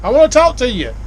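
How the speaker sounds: high, carrying call to come back; yelling after him. Retold or imitated. Shouting after the dockmaster as he rides away, high carrying yell. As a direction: yell across the dock for him to return. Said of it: "I'm yelling at him".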